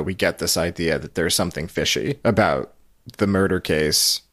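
ordinary delivery

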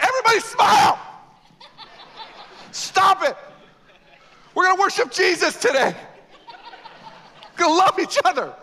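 shouted high, strained cheery orders; frantic parent in the driveway. Retold or imitated. Parent at church door ordering family to smile, shrill shout. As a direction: force the family to smile, almost yelling. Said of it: "it's like".

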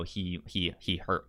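normal talking voice

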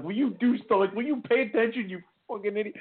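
high, exasperated yell at the driver ahead; mock road rage. Friend flashing high beams at driver ahead, high mock yelling. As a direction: fake fury at a clueless driver. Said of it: "I'm like".

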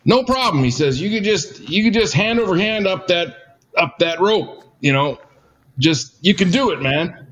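high, upbeat mock-encouraging voice; Dwayne's voice. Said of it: "He goes".

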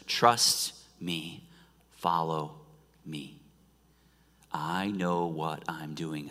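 soft, low, slow and tender; Jesus gently reassuring his followers, soft and low; gentle voice of Jesus. Acted. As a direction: speak like a calm shepherd reassuring. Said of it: "he simply says".

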